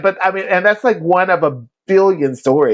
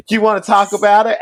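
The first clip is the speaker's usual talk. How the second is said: gentle question; 8 semitones higher, faster than usual. Retold or imitated. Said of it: "He just says".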